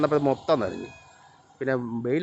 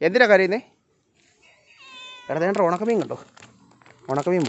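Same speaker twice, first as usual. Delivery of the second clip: loud, higher call across the yard; calling the cat; 6 semitones higher, 3 dB louder than usual. Real, not acted. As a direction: ask it loudly, pitched up.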